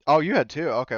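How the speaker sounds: ordinary delivery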